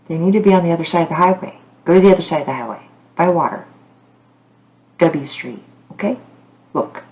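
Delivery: loud, urgent, fast frustration; frustrated. Real, not acted. To the police searching the wrong spot, frustrated and loud. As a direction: push the words out, fed up with the search. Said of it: "it's my frustration".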